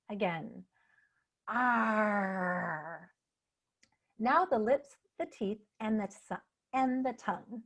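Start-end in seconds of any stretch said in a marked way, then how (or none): 1.5-3.1 long drawn-out "arrr" growl. Shown as a demonstration. Speech coach warming up tongue and soft palate, growling. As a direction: hold a growled R, sliding gently down. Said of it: "soft palate by growling".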